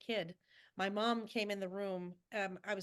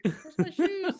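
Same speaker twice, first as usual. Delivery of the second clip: high, playful mock bewilderment; mock bewildered kids; 7 semitones higher, 6 dB louder, much slower than usual. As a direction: pretend to hunt for lost shoes, pitched up. Acting out pranked kids in hallway.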